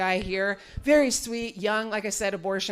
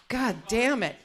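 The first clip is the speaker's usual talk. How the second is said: ordinary delivery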